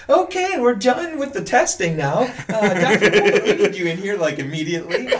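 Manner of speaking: high-pitched mock urgency; panicked nurse. Nurse calling the doctor in as the baby crowns, urgent. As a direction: imitate a nurse raising her pitch in alarm.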